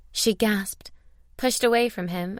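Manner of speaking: ordinary delivery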